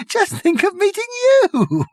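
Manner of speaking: very high, gasping through laughter; laughing. Acted. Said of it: "he gasped".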